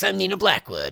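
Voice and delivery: lightly mimicked VJ introducing herself; Nina Blackwood. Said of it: "she talked like this".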